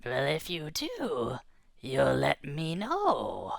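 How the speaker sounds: slow, soft big-fish question. Acted. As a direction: drag it out like a lurking predator. Puzzled big fish replies.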